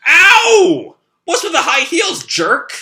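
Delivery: loud high yell of pain, then insulting; pained angry yell. Acted. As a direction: shriek at the jerk who stepped on you. Stepped on by stilettos, yelling.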